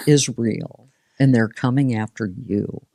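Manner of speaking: normal talking voice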